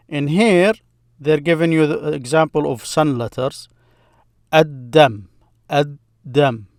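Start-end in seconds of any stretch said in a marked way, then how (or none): 4.5-6.7 Ad-Dam with a doubled D and no L; silent L. Shown as a demonstration. Teacher giving a sun letter example, Ad-Dam without the L. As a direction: drop the L, holding the D in Ad-Dam. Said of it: "I did not pronounce the L there".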